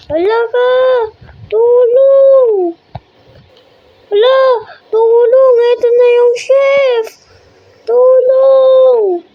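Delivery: high, loud panicked cries for help; screaming for help. Real, not acted. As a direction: scream for help in real fear. Chased by chef, screams help.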